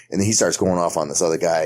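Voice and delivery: normal talking voice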